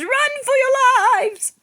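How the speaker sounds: high loud panic; panicked yell. Acted. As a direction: yell it while running away. Soldiers fleeing in panic.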